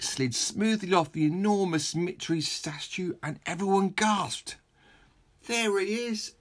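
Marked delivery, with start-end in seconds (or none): 5.5-6.3 Squarehead voice. Acted. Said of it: "said Squarehead".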